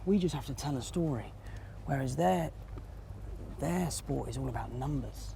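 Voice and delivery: quiet, hushed golf-course voice; hushed tones. Real, not acted. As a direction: keep it down so the golfer can play. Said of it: "speaking in the hushed tones".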